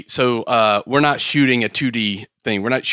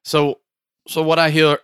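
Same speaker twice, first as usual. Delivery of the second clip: ordinary delivery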